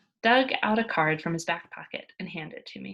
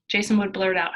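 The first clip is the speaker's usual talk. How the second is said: plain reading voice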